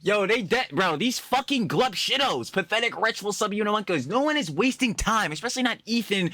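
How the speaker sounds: heated high rant. Real, not acted. Ranting at the snarkers, high and outraged.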